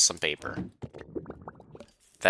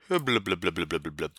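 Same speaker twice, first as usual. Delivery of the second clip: shouting underwater; faster than usual. Pretend. Swimming underwater, mock bubbling noises.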